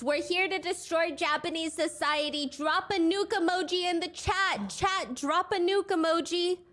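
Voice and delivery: lightly imitating a provocative streamer; mock Ramsey. Narrator voicing Ramsey's stream quote.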